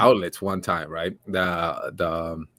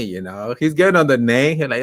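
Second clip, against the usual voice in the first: usual speaking voice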